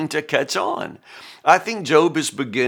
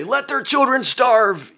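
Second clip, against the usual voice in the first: high, drawn-out mock outburst; hammed-up cursing, pitched way up and stretched; mock curse; 11 semitones higher, 4 dB louder than usual. Preacher mimics a curse Job would never say, mock outrage.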